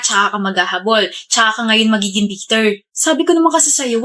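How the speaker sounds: ordinary talk, nothing marked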